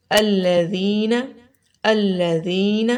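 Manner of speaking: الذین slowly, with a soft th as in "this"; soft dhal. Shown as a demonstration. Teacher gives an example word, slow soft الذین without whistle. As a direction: draw out الذین, no whistle on the consonant. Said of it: "بہت نرمی کے ساتھ اور سیٹی کی آواز بھی نہیں".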